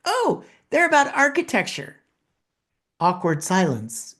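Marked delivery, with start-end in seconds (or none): 0.1-2.0 lightly voiced colleague, pitched higher; a hint of shy, bright-eyed Ryan answering; imitating Ryan. Retelling Ryan's answer to his boss, lightly voiced and higher. Retold colleague's reply to question.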